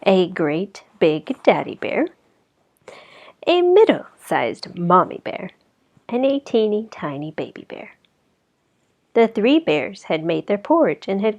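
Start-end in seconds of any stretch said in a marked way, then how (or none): none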